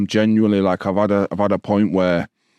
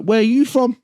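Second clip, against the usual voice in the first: imitating the local lads; 12 semitones higher than usual. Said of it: "So, like".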